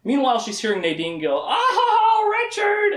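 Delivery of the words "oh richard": high, louder ecstatic cry; mock scream. Nadine heard next door, a mock ecstatic scream. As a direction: squeal his name in ecstasy. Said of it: "like, screaming and stuff".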